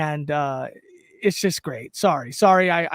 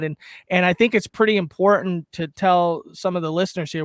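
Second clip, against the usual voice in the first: ordinary delivery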